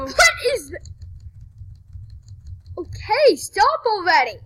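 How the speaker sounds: loud exasperated shout. Real, not acted. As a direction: yell at him to stop. Teammate annoying him in game.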